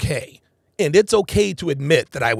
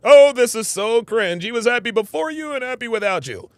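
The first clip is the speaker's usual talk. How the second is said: high, loud, whiny mock complaint; mock cringing; 6 semitones higher, 6 dB louder than usual. Streamer imitates Destiny hearing the vows, mock cringing. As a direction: wail it in hammed-up embarrassment.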